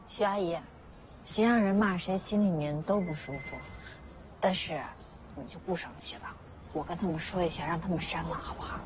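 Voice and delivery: hushed, low coaxing; soothing. Acted. Volunteer promising an auntie to get it deleted, soothing. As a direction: talk her down softly and gently.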